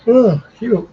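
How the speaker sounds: loud oh-no reaction; dismayed exclamation. Real, not acted. Fan reacting to the replay of the penalty, loud dismay. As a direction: cry out as the replay shows it.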